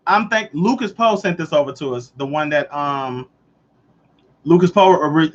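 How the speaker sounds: ordinary talk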